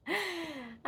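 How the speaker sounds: high gasp of wonder; delighted gasp. Real, not acted. Vlogger seeing the laser lights, delighted gasp.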